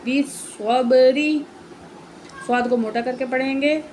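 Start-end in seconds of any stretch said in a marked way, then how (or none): 0.1-1.4 every sound stretched very slowly; slow blended reading. Shown as a demonstration. Teacher joining syllables into a word for the pupil, very slow. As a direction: draw the joined word out carefully. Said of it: "تو پڑھتے ہیں".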